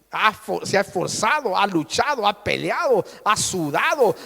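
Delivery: ordinary delivery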